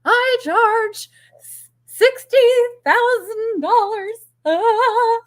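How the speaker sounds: very high, loud squeal of nerves; mock hysterical high-pitched price pitch; giggling nervous break. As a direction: stretch it out slowly, squeaking into giggles. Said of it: "You're gonna start giggling".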